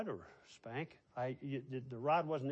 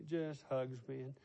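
ordinary delivery

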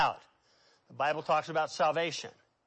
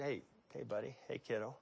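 much softer, close and hushed; almost breathed, like soothing a child; hushed tender; 7 semitones lower, 16 dB quieter, much faster than usual. Pretend. Jesus hushing the paralyzed man on the mat, very soft. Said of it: "that's the way I would say it".

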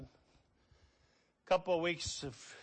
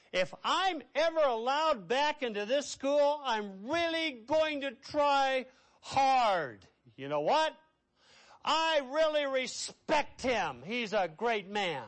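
put-on much higher, slow, earnest boy's voice; the expelled boy; 10 semitones higher, 6 dB louder than usual. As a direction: plead slowly like a remorseful teenage boy. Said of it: "And this boy says".

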